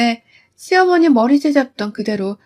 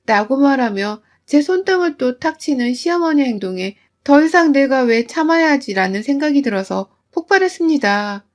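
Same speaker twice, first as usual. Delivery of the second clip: ordinary delivery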